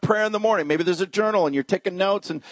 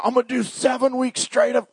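overeager pledge; 7 semitones higher than usual. Acted. Mimics overeager commitment.

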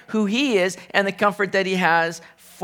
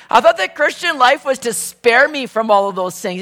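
higher and louder, with a laugh; 5 semitones higher, 6 dB louder than usual. Pretend. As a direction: laugh it out in mock disbelief. Imagined complaint repeated with laugh.

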